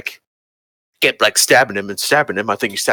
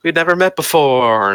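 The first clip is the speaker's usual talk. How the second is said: suspicious and coy; 7 semitones higher than usual. Retold or imitated. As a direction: play someone coyly denying everything. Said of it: "suspicious and coy".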